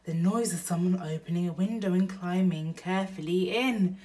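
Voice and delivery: ordinary delivery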